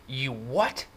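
high, disbelieving outburst; incredulous shout. A narrator's reading. Brother hearing kid slept in lot, shouting in disbelief. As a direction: pounce on it in disbelief. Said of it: "he was shouting".

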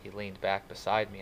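ordinary delivery

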